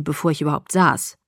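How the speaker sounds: ordinary delivery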